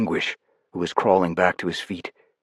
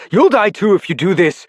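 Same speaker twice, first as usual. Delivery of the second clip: urgent warning; 11 semitones higher, 5 dB louder than usual. Acted. Pleading warning.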